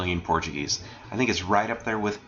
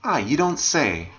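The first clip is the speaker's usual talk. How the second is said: slow, raised-pitch mock amazement; higher and slower, feigning surprise; sarcastic; 6 semitones higher, 4 dB louder than usual. Shown as a demonstration. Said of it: "If you want to express sometimes sarcastically".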